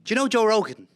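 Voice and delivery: put-on high, loud Irish accent. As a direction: open the pitch in lively Irish. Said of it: "give an elevator pitch in Irish".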